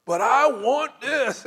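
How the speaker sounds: loud, high, drawn-out demand; mock tantrum from a frustrated caller; hammed-up insistent whine, stretched out; upset customer. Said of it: "You're just gonna blow up".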